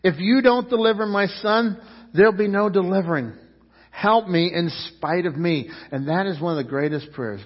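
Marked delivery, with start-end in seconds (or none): 0.0-5.7 raised, pleading cry to Jesus; father begging loudly; loud desperate plea. Acted. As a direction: cry out for the son's deliverance. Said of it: "comes to him and says".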